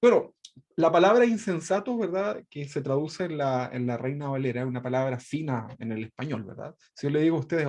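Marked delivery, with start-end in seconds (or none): none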